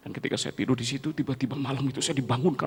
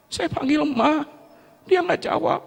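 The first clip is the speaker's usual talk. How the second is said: high, loud grieving cry; grief-stricken; 3 dB louder than usual. Real, not acted. Son calls his dead mother, grieving loudly.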